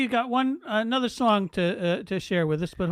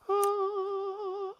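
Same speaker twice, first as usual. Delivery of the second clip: high, soft royal sigh; a queenly appreciative ah; 7 semitones higher, 5 dB quieter than usual. Retold or imitated. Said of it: "for Her Majesty".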